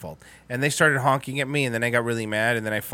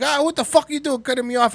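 put-on angry old man; 9 semitones higher than usual. Old foreign driver complaining about being cut off, high mock-angry voice. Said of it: "he was, like, foreign".

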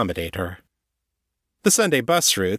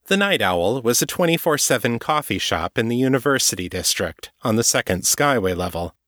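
usual speaking voice

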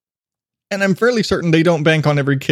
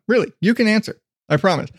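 ordinary talk

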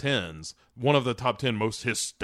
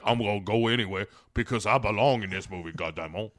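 Cajun Brimley voice; 3 semitones lower, faster than usual. Retold or imitated. Podcaster mimicking Killer Croc as a Cajun Brimley, fast. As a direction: rush it in a Cajun old-man accent. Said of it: "why he went Cajun Brimley".

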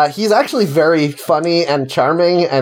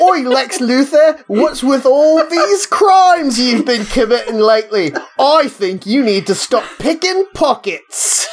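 high, slow hammed-up Cockney accent, laughing through it; a Mary Poppins-style fake Cockney Superman scolding Lex Luthor; 7 semitones higher than usual. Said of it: "like, a Cockney accent".

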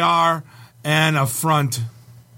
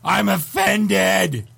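a hammed-up cry of offence; drawn-out pretend indignation; mock offended; 5 semitones higher, much slower than usual. Mock outrage at a foreign greeting.

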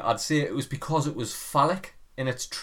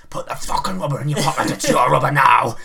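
loud, forceful bark; shouting inner voice; 9 dB louder than usual. Retold or imitated. The id shouting at the child, loud and bossy. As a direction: roar the order like a bossy impulse.

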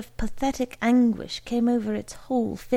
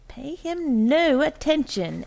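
raised, emphatic wifely exclamation; exclaiming; 6 semitones higher, 4 dB louder than usual. A narrator's reading. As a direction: exclaim it higher, brushing the husband off. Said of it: "exclaimed Missus Troy".

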